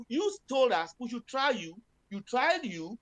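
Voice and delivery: ordinary talk, nothing marked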